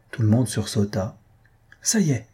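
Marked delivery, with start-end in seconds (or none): 1.8-2.3 mild cry of success, pitched high. A narrator's reading. Clockmaker announcing the chime works, crying out. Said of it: "cria Blanquette".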